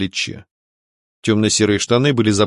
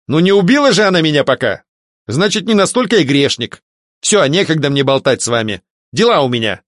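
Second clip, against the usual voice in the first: lifted, dismissive blurt; 6 semitones higher, 3 dB louder than usual. A narrator's reading. Man brushes off the priest before leaving, blurting. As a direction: blurt it out to end the chat. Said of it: "выпалил он".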